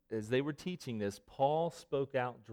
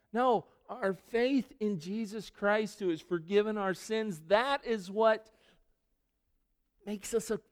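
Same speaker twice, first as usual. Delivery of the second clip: higher, insistent preaching voice; raised preaching; 9 semitones higher, 5 dB louder than usual. Acted. Pastor assuring believers, in a higher preaching voice. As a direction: lift it up and press the point home.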